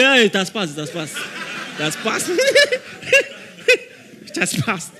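laughing through a smug told-you-so; mock laughing boast. Preacher mocking the hindsight believer, laughing. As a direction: cackle it has passed like a show-off.

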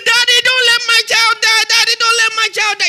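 high, loud, pleading wail; desperate begging prayer. Retold or imitated. Preacher mocking a fearful parent's begging prayer, wailing. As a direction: beg Daddy in a frantic wail. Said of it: "Don't say".

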